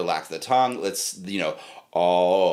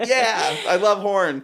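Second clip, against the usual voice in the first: naive student voice; 9 semitones higher, 3 dB louder, slower than usual. Retold or imitated. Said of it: "And I'm like".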